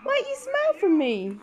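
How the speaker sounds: high sing-song coaxing. Real, not acted. Coaxing a laughing baby.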